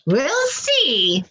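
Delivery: a hint of a knowing witch; sly witch. A narrator's reading. Witch teasing the frog-to-be, lightly sly. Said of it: "laughing to herself".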